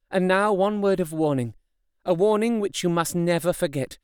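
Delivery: a hint of a resolute young woman; Mina's voice. A narrator's reading. Mina warns the men.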